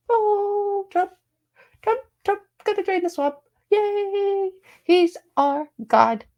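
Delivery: high, squealing fan cheer; mock cheering supporter. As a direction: gush like a starstruck fan. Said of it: "constant, they were talking".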